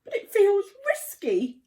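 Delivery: high, loud, anxious sputter; sputtering Zora. A narrator's reading. Frightened friend protesting to the lion, sputtering high and loud. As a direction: blurt it out nervously, voice jumping up. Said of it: "sputtered Zora".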